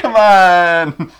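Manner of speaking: loud, high exasperated yell; shouting. Retold or imitated. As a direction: yell it out in disbelief. Friends yelling at Nick.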